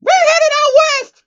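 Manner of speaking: very high, loud squeal; screaming. Acted. Pinkie Pie announcing the trip, high excited scream. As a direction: burst out in excitement. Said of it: "Pinkie Pie screamed".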